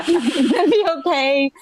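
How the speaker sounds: husband's calm down broken by giggles; laughing reassurance. Retold or imitated. Retelling her husband on the phone, laughing through it. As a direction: laugh through calm down, you're gonna be okay. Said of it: "he's like".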